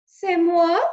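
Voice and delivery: loud, high French "C'est moi"; proud French declaration; French exclamation; French accent. Acted. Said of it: "said Antoinette".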